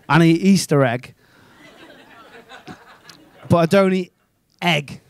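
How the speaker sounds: ordinary talk, nothing marked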